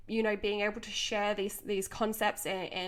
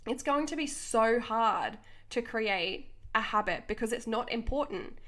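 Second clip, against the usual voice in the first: ordinary talk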